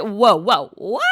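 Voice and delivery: a loud parental whoa; alarmed mom. Retold or imitated. Mother reacting to daughter's tattoo plan, in loud mock alarm. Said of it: "And I'm like".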